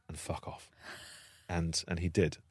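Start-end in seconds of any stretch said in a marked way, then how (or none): 0.1-0.7 hissed under the breath; whispered. Retold or imitated. Sending the troll off at the bar, whispered. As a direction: say it low so only he hears.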